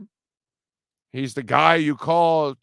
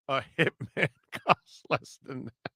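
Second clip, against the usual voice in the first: soft, laughing repeat; 5 dB quieter than usual. Real, not acted. Host echoing the caller's hitman line, laughing. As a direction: say it through helpless laughter.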